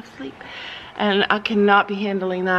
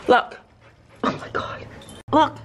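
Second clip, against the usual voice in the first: usual speaking voice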